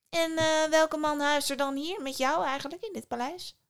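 high-pitched, put-on nosy voice; prying sister. A narrator's reading. Eldest sister quizzes Psyche about her husband, prying.

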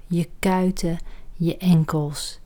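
ordinary delivery

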